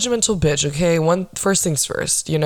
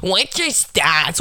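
slow, squawky mock voice; exaggerated mocking impression of a nagging guy; mocking a critic; much slower than usual. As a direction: mimic the heckler with drawn-out ridicule. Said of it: "the one fucking guy".

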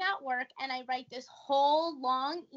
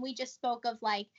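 ordinary talk, nothing marked

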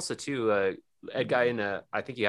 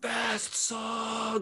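much higher mock crowd shout; imitating the whole crowd screaming; crowd yell; 14 semitones higher than usual. Crowd screaming for a fast song, mock yell. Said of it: "everyone's like".

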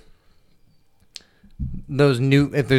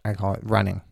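ordinary talk

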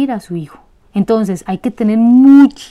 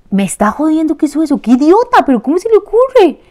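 casual exasperation; 6 semitones higher than usual. Shown as a demonstration. Chatting with a friend about someone's blunder, lightly exasperated. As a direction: toss the insult off lightly, amused disbelief. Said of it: "Lo dije en ese tono".

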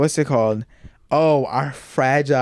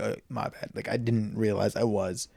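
much softer, lower voice; sheepish apology; 4 semitones lower, 10 dB quieter than usual. Retold or imitated. Apologizing after she calls out the raised voice, sheepish and quiet. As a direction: back right down into a quiet apology. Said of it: "why are you raising your voice".

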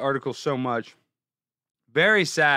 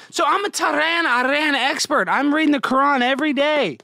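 mock boast; 9 semitones higher, 6 dB louder than usual. Repeats the Iran-expert boast.

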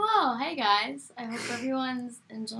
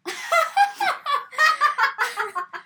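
long squealing laughter; loud high laugh; 8 dB louder than usual. Real, not acted. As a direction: crack up loudly. Laughs at groaning friend.

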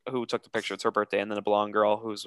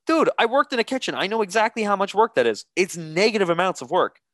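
high, loud exasperated argument; retold indignant protest; 9 semitones higher, 5 dB louder than usual. Ex-cook arguing with bartender over cutting limes, indignant. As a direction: protest incredulously with pitch way up.

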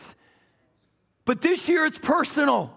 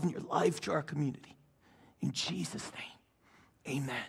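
prayer voice; 5 semitones lower than usual. Acted. Leads congregation in prayer.

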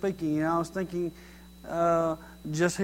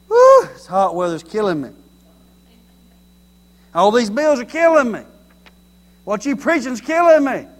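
loud, rising hammed-up moans; mock complaining; 4 semitones higher, 10 dB louder than usual. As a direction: wail each gripe as if it's too much. Acting out everyday complaints.

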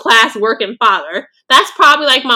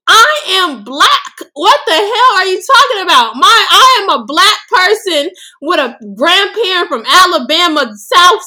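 high, emphatic real exasperation; indignant outburst; 7 semitones higher, 3 dB louder than usual. Real, not acted. Answering critics who say she talks about race too much, indignant. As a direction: fire back incredulously, pitch jumping up.

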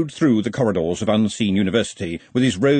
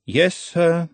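mildly resigned assistant, pitched up; understated tired compliance; weary; 9 semitones higher than usual. A narrator's reading. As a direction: answer your boss with a touch of weariness. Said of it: "said Ponder wearily".

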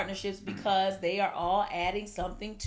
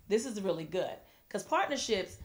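ordinary talk, nothing marked

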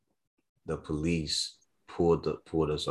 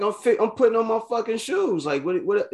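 much higher, incredulous indignant protest; exasperated retort. Retold or imitated. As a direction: fire back in disbelief at the cop.

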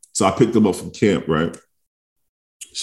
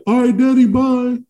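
put-on high little kid voice saying bye; sing-song kid calling goodbye; mimicking his kids; child voice; 3 dB louder than usual.

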